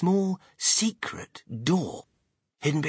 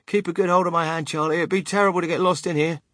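quick, raised, lightly voiced warning; hurried, slightly higher cautioning; anxious grandfather; 6 semitones higher, 5 dB louder, faster than usual. Grandfather warns boy while hurrying.

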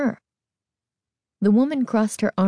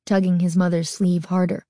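ordinary delivery, nothing marked

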